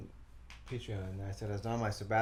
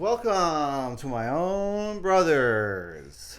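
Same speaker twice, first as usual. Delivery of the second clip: slow, loud announcer welcome; slow, loud showman welcome; grand show greeting; 4 semitones higher, 6 dB louder, much slower than usual. Acted. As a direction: stretch it out like a showman.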